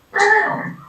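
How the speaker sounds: shouted high, loud impact sound; explosive shouted bam; sudden loud bam. Acted. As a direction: slam it out like a wave hitting. Wave knocks him down.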